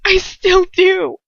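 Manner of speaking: slow tearful insistence; voice thick with tears. Acted. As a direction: squeeze the words out through tears. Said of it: "my voice thick with tears".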